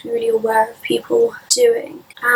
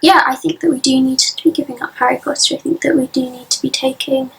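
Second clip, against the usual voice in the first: ordinary talk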